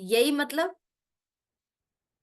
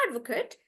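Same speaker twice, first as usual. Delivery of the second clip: "advocate" softer, with short "kut" ending; stress on AD; 7 dB quieter, faster than usual. Shown as a demonstration. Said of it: "pronouncing this as a noun".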